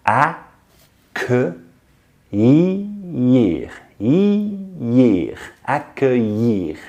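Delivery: accueillir drawn out very slowly, syllable by syllable; slow breakdown. Shown as a demonstration. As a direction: accueillir stretched out very slowly to show the ill sound. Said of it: "Just focus on the sounds".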